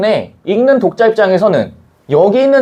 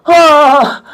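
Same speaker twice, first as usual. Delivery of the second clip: high, loud pained groan of dismay; a teacher's hammed-up groan; mock dismay; 8 semitones higher, 5 dB louder, much slower than usual. Real, not acted. Teacher to students who never saw Sherlock, groaning in dismay. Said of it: "괴리감 느껴져".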